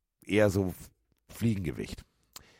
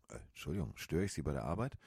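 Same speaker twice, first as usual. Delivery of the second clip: timid apology; 6 semitones lower, 10 dB quieter, faster than usual. Retold or imitated. Guest timidly addressing hotel staff.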